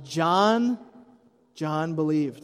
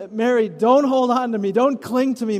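raised, higher, urgent; urgent plea; 4 semitones higher, 5 dB louder than usual. Retold or imitated. Jesus urges clinging Mary to let go, raised and urgent. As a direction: hold her back with loving urgency.

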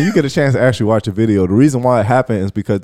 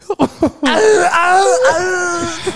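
high strained wail; high strangled cry. Retold or imitated. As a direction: wail like the wind's knocked out. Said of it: "He said".

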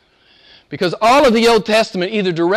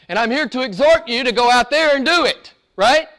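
higher, rising pulpit exhortation; fired-up preaching; 5 semitones higher than usual. Real, not acted. Preacher rousing his church to action, lifted preaching voice. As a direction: drive the charge home to the congregation.

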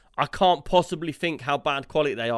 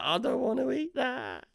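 put-on childish refusal quote; 11 semitones higher, 3 dB quieter, slower than usual. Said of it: "And I'd be like".

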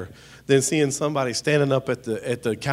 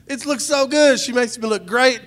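gushing delight; 11 semitones higher, 7 dB louder than usual. Retold or imitated. Pastor gushing over a selfie with his wife, high and loud. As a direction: gush over the picture. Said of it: "and I said".

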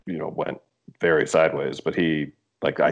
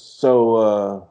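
louder, higher taunting jab at a stranger; sneering stranger; 5 semitones higher, 3 dB louder than usual. Retold or imitated. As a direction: needle the guy about his shirt. Stranger sneering at CBC shirt.

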